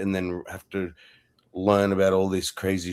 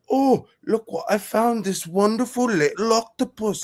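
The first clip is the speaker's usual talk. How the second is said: high, posh-sounding British tourist voice; British accent; 13 semitones higher than usual. Retold or imitated. Imitating a British father showing his kids an octopus. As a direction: gush like a British dad on the beach. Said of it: "in this British accent".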